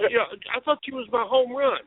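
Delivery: high-pitched imitation of street talk; mimicked TV slang. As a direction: tipsily parrot slang from TV. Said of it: "so I mimicked everything they said".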